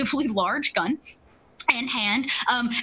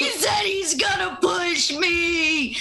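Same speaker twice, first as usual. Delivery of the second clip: high, slow fake-crying wail, half laughing; fake crying kid; 9 semitones higher, slower than usual. Street kid fake-wails to the crowd about being pushed. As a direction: ham up a kid's crocodile tears. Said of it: "just a fake cry as loud as he can go".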